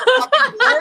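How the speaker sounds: high, loud incredulous laugh; laughing disbelief. Real, not acted. Mocking Jasmine's choice of Gino, laughing disbelief.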